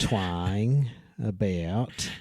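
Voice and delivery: "twang" and "about" with exaggerated vowel glides; twangy Aussie "about"; Australian diphthongs. Shown as a demonstration. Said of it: "put a lot of, uh, like, a diphthongs".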